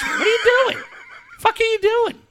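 very high-pitched mock scolding; shriek-like retold outrage, edged with laughter; imitated yelling. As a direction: imitate an appalled friend telling you off. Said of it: "don't need the other one yelling at you".

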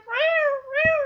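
high tearful meowing; high wailing mews; crying cat. Retold or imitated. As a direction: imitate a pitiful cat while crying. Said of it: "that poor cat going".

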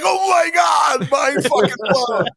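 slow, high, drawn-out mock shout; mock amazement. Mocking ghost hunters being overexcited, slow high shout. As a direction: stretch it out like a dazzled ghost hunter. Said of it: "It's just like".

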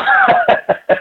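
a high burst of real laughter; laughing. Real, not acted. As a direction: laugh out at the memory. Laughs at own story.

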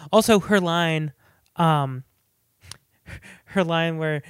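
ordinary delivery

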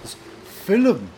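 "film" said as two syllables, "fil-um", Irish style; Irish way: "film" becomes "fil-um"; Irish "filum". Shown as a demonstration. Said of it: "They say in Ireland".

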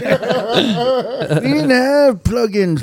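put-on high, creaky old man voice, loud and laughing. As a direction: play a grumpy old-timer. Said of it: "Give me the old man voice, [name], 'cause you do it good".

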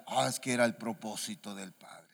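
low, soft imitation of religious people; mock-devout murmur; mock piety. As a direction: parrot a pious answer, hushed and low. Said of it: "Muy religiosos ustedes, hombre".

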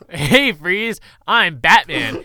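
loud, hammy mock catchphrase; Batman, done wrong. Podcaster mocking Batman and Robin's entrance, loud and hammy. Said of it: "That's not how it's done".

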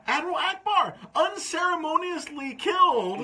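high, drawn-out indignant protest; outraged rant. Real, not acted. Fan protesting Admiral Ackbar's unceremonious death, outraged. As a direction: spell out the outrage slowly, pitched high.

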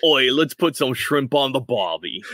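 hammed-up Aussie catchphrase; mock Australian, played for laughs; Australian accent. Shown as a demonstration. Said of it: "That's my Australian accent".

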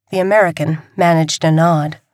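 plain reading voice

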